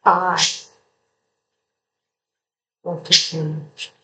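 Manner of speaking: "I" isolated by a long pause; stress on I. Shown as a demonstration. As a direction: say "I", wait, then softly finish the phrase. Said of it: "putting the inflection on certain words".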